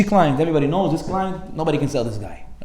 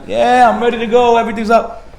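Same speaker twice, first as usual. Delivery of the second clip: eager friend; 6 semitones higher, 4 dB louder than usual. Retold or imitated. Friend calling late at night, eager and pumped. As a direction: sound ready to go out.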